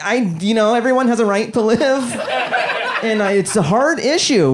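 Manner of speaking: mock a nervous liberal who can't commit; hand-wringing liberal.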